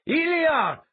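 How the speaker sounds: loud, high-pitched yell of a name; shouted warning. A narrator's reading. Althalas warns his friend of an attacker, shouting. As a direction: yell it out urgently to save him. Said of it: "Althalas shouted".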